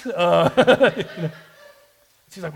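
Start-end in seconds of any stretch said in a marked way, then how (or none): 0.6-1.1 short, high, loud nervous laugh. Real, not acted. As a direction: laugh off the awkwardness. Awkward moment in the story.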